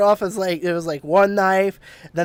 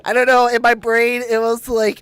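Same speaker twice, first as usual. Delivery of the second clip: laughing while talking; giggling through the words; 5 semitones higher, 5 dB louder than usual. Real, not acted.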